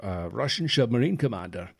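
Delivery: put-on slurred Scottish accent, "submarine" said as "shubmarine"; Sean Connery. Podcaster imitating an actor's Russian commander, Scottish accent. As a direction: mimic a Scottish film star's slushy "sh" for s. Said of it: "everything in his accent".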